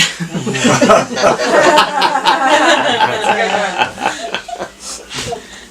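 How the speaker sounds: long laughter after a joke. Real, not acted. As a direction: laugh at your own pun.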